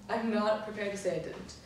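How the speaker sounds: usual speaking voice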